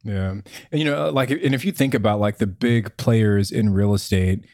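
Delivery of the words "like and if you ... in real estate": usual speaking voice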